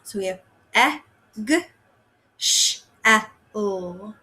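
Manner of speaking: eggshell split into e, g, sh, e, l; teacher segmenting eggshell for the class; sounds segmented. Shown as a demonstration. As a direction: sound out each phoneme of eggshell. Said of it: "if we're blending it".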